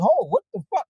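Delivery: sudden high shocked yelp of disbelief; a startled high "what the fuck". Retold or imitated. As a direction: jump up in pitch, stunned. Driver realizes they're dudes.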